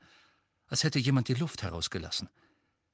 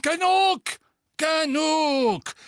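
much higher, louder barked command; shout; 6 dB louder, much slower than usual. Acted. Fudge cutting off Harry in court, shouting. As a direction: slam the story shut.

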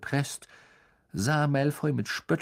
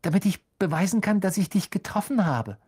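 put-on high, fast, eager boy; eager young fan; 7 semitones higher, 3 dB louder, faster than usual. A narrator's reading. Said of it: "sagte Colin Creevy begierig".

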